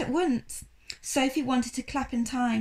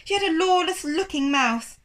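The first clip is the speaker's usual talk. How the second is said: sniffy assistant; 6 semitones higher, 5 dB louder than usual. Acted.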